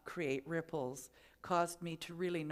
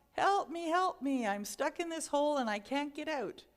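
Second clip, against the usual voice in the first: higher, louder desperate calls for help; yelling for help; 7 semitones higher, 5 dB louder than usual. Retold or imitated. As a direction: shout up from the bottom of the hole. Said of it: "And he keeps yelling".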